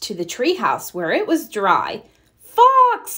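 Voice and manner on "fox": high, loud call across the yard. Acted. Mother calling Fox in from the storm, loud call. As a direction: call the child in from afar. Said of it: "called his mother".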